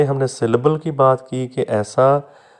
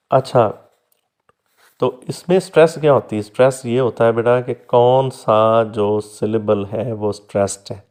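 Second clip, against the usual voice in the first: normal talking voice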